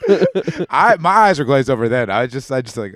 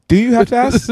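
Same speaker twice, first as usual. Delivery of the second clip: mock question; 13 semitones higher, 7 dB louder than usual. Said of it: "It's like".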